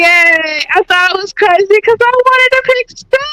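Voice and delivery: high, excited cheer; high-pitched voice. Real, not acted. Guest cheering her Steph Curry pick, high-pitched. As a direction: pipe up in a high voice. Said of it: "who over here with the high pitched voice".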